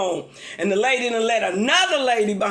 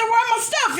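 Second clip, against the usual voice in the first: high, indignant squeal of disbelief; mock outrage at the cashier pitched way up; indignant demand; 10 semitones higher than usual. Customer to cashier, high indignant squeal.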